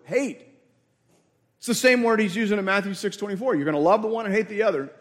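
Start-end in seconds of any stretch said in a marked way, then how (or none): none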